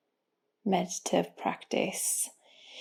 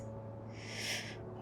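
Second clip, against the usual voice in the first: long, airy sigh of release; relaxing sigh; 14 dB quieter than usual. Shown as a demonstration. Guide showing listeners how to let the shoulders go, relaxing sigh. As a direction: let the breath fall out slowly. Models letting the shoulders sink.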